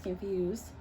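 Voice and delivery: plain reading voice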